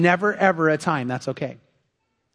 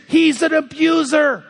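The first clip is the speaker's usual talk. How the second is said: higher-pitched, hammed-up wail of a wronged wife; mock tearful outburst; 6 semitones higher than usual. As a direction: melodramatic imitation of an upset wife. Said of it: "She breaks into tears".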